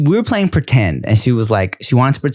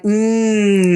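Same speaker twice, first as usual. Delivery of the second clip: put-on high, drawn-out childlike Mm; 7 semitones higher than usual. Shown as a demonstration. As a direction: coo the Mm up high. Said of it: "Say like".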